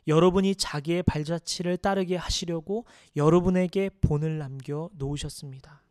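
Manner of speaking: loud, measured scripture reading; solemn, projected Bible reading. Acted. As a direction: read the passage out firmly to the congregation. Reads scripture aloud to congregation.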